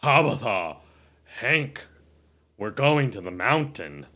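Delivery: low, loud, slow ogre voice; big lumbering ogre announcing a journey; character voice. Acted. Ogre calls his dogs to the mountain, loud low character voice. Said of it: "in your character voice".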